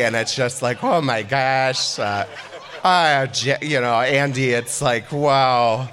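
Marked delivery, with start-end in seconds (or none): 0.8-3.6 tipsy rambling. Retold or imitated. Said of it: "drunkenly clung to the podium". 5.1-5.9 tipsy rambling. Retold or imitated. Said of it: "drunkenly clung to the podium".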